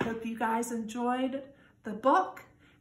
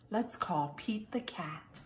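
sick Santa whispering the idea; 4 dB quieter than usual. Acted. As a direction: breathe it out in a hushed whisper. Sick Santa calls for help.

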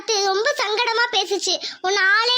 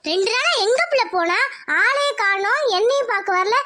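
usual speaking voice

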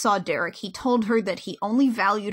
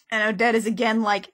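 ordinary delivery

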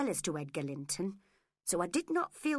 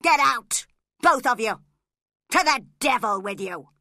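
much louder roar, get out; furious shout; 3 semitones higher, 13 dB louder than usual. Acted. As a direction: bellow them out of the room. Drunk Hindley throws them out.

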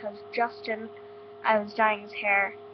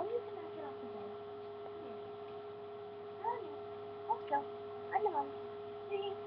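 pet talk; 10 semitones higher, 11 dB quieter, faster than usual. Real, not acted. Coaxing bunny off the bed.

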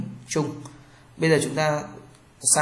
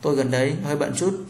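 ordinary talk

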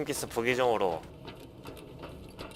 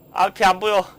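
higher, louder panicked cry; blinded panic, raised voice; panicking gamer; 8 semitones higher, 6 dB louder than usual. Real, not acted. Blinded by flashbang.

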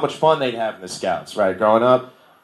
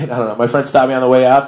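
ordinary talk

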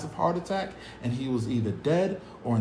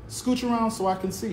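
mother's request; 9 semitones higher than usual. Acted. Mother voiced in story.

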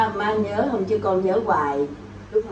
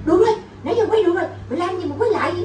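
quick, high-pitched mock protest; imitating mother's refusal; 7 semitones higher, faster than usual. Actress quoting mother refusing to reshoot, fast high protest. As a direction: refuse the retake in a rush. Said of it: "má không chịu quay lại".

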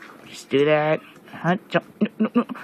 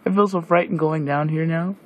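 normal talking voice

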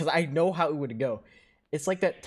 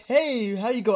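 excited stranger greeting; 11 semitones higher, 7 dB louder than usual. Retold or imitated. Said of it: "So I'm like".

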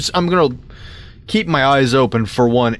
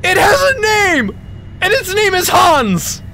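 high, loud squeal of delight; thrilled shouting; 3 dB louder than usual. Real, not acted. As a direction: exclaim it in giddy, high-pitched amazement. Thrilled by named flamethrower.

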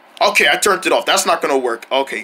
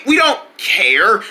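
slow, hesitant restatement; toned-down backpedal; much slower than usual. Acted. Jungle restates it after his outburst, slow and subdued. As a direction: ease into it slowly at usual volume.

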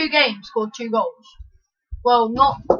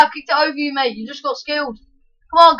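ordinary talk, nothing marked

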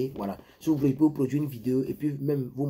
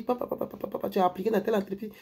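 mimicking the lady; 6 semitones higher, 3 dB quieter, faster than usual. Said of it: "elle dit".

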